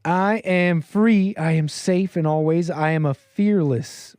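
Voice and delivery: slow, grand mock affirmation; mock dramatic. Host mocking a celebrity's written affirmations, slow and dramatic. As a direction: read it out with drawn-out, overblown conviction. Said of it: "So dramatic".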